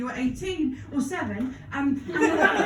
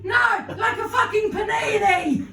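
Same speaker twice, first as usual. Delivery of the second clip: higher, louder, slower snapping mum; exasperated mock shout; 6 semitones higher, 4 dB louder than usual. Mother snaps back at her child, loud mock shout. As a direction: bark it out like a fed-up mum.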